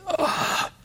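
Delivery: high, soft breathy "ah"; overcome sigh. Retold or imitated. Preacher overcome by Jesus's friendship, soft awed sigh. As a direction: sigh it out softly, without voice.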